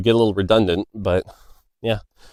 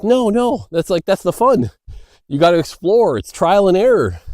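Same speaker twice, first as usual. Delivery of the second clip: higher-pitched, insistent fan defending the game; imitating eager fan; 8 semitones higher, 3 dB louder than usual. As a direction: argue back like an enthusiast, pitched up.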